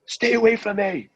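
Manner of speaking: high, half-laughing frightened yell; terrified yelling. Retold or imitated. Tori yells at the crowd he thinks are vampires, high frightened yell. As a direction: shout them off like they're vampires. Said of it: "Tori's like".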